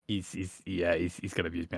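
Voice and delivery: normal talking voice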